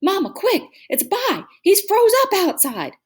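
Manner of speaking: put-on high, loud, breathless-child urgency; urgent alarm. A narrator's reading. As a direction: raise the alarm to Mama. Child raising alarm for stuck brother.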